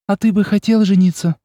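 Olga's voice. A narrator's reading. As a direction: ask with surprised curiosity.

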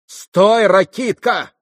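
high, loud cry to stop him; sharp shout. A narrator's reading. Grushenka jumps up shouting.

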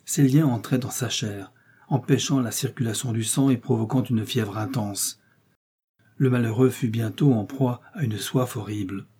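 ordinary delivery, nothing marked

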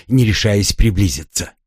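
usual speaking voice

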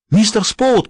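high call into the dark. A narrator's reading. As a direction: call out for him, panicked. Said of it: "позвала она".

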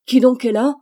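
high, loud startled shout; frightened shout. A narrator's reading. Emmanuel bursts in from the study, frightened shout. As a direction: bark it out in alarm. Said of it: "s'écria-t-il".

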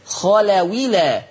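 "خلولا" slow and linked, khalawilā with no hamza; نقل الهمزة. Shown as a demonstration. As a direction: glide from the waw straight into -ila in "خلولا". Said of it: "هكذا". ("خلولا" موصولة بواو مكسورة دون همزة)

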